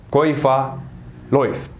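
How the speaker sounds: ordinary talk, nothing marked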